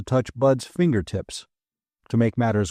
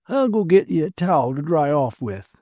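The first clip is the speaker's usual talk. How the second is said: a hint of a kindly old man; friendly old man offering help; lightly voiced old man; 5 semitones higher than usual. A narrator's reading. Old man helps Bud.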